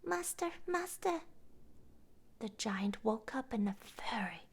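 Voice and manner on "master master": high calling voice, a touch of alarm; harp calling out. A narrator's reading. Magic harp calling its master, high. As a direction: cry out to wake the master. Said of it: "the harp called out".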